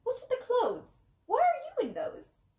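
yelling clown. A narrator's reading. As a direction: shriek it like a suspicious clown. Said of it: "He looked at us suspiciously and yelled".

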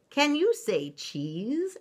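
high, slow teacher's question; French teacher. A narrator's reading. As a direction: ask it slowly in a high classroom voice. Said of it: "asked the teacher".

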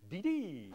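quick, unstressed "D-dee"; swift pyrrhic. Shown as a demonstration. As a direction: skip lightly through "D-dee" without a stress. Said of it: "becomes the swift-moving".

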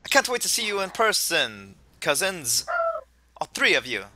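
lightly put-on Jamaican professor; attempted Jamaican accent. Host as professor looks forward to meeting players, Jamaican accent. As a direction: lift it in a hammy Jamaican accent. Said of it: "I can't do a Jamaican accent".

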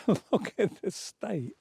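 slow, laughing through the words. Real, not acted. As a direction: laugh at the mess you're in. Laughing at snow-covered self.

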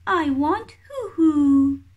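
high, whimpering chick wail; whiny crying chick. Acted. Baby kiwi begging mum for hoo hoo, high whimpering whine. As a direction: whine it like a hungry baby about to cry. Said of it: "says Hooey".

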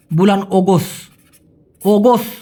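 অগস্ট with an open o, the t nearly dropped; Malay pronunciation. Shown as a demonstration. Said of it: "বলা হয় অগস্ট". (Malay উচ্চারণে অগস্ট)

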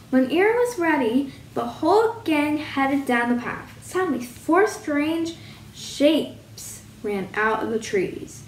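usual speaking voice

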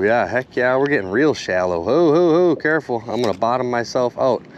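ordinary talk

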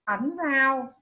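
ordinary delivery

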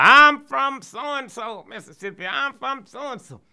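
high, loud, slow shout from the crowd; yelling out. Retold or imitated. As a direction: holler it across the room. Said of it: "they was yelling out".

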